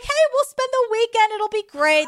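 high, bright put-on sweetness; mock cheery kidnapper. To Cindy, faking a fun weekend, sugary mock cheer. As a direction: sell the trip like a harmless getaway. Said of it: "He's like".

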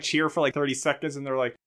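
normal talking voice

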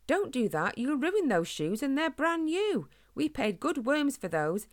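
exasperated parent, pitch climbing; scolding father. Acted. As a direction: scold a child over ruined new shoes. Father scolds son kicking stones.

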